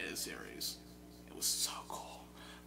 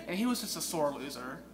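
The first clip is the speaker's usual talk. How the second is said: ordinary talk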